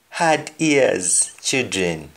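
put-on slowly, Hard ears children; slow teacher gloss; slow explaining. Shown as a demonstration. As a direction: spell out the meaning slowly. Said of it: "we call them".

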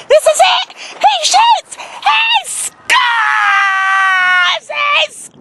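from urging to a cheering shout; building excitement. Acted. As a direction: commentate it like a goal call. Son shoots and scores.

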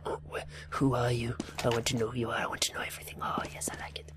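hushed, very soft mock-stalker voice; creepy whisper. Gamer prying into a player's profile, creepy playful whisper. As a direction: whisper it low, as if secretly prying.

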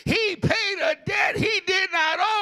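slow shouted preacher climax; preaching climax shout. Acted. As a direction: roar it as the sermon peak.

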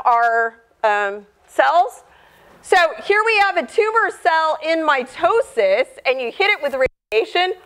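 usual speaking voice

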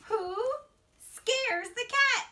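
put-on sing-song storybook read-aloud for little kids; reading to children. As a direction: read it like a bedtime picture book, rising on each new animal. Reads picture book to children.

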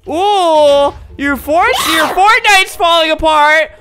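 loud, slow, gloating sing-song; gleeful taunt. Real, not acted. Gamer taunting her friend's fort, loud and gleeful. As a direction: rub it in as the fort collapses.

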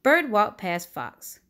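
plain reading voice

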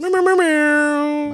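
high, slow cat meows; cat meowing. Pretend. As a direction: meow like a cat. Cat noises over the outro.

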